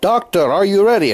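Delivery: high, loud call across the room; nurse yelling out. Retold or imitated. Nurse calls to the doctor's group next door, high loud yell. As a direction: call out loudly so the other section hears. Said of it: "she'd yell out".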